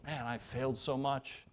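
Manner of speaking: soft, heavy admission of failure; quietly regretful sigh. Retold or imitated. Pastor echoing a parent's guilt, soft and rueful. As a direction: let the regret sink in softly. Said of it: "I feel that".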